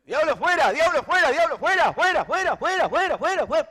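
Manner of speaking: screaming fuera over and over at the devil; mock exorcist yelling. Preacher mimics a minister screaming at the devil. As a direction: shout the demon out, full force. Acted deliverance shouting at demon.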